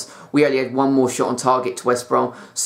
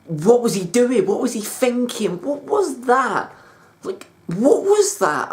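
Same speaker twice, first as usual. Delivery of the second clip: much higher, incredulous furious questions; fuming disbelief; 10 semitones higher, slower than usual. Real, not acted. Arsenal fan raging at referee's penalty call, high-pitched outraged disbelief. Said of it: "I'm fuming".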